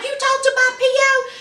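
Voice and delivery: high, incredulous question; outraged disbelief. Retold or imitated. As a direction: squeak it up in disbelief. Husband outraged at wife.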